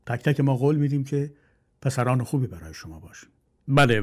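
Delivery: usual speaking voice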